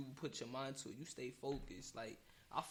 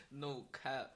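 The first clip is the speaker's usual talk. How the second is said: ordinary talk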